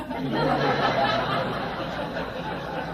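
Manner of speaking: long breathy laugh; real helpless laughter. Real, not acted. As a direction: laugh at your own story. Laughs at his own impression.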